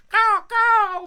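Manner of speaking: high, blaring parting caws; loud crow caws. Pretend. Crow saying goodbye, loud high cawing. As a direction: caw loudly as the crow flies off.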